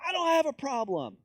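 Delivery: loud, high-pitched protest; angry denial. Acted. Confronted person denying it, angry and loud. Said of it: "more angry about it".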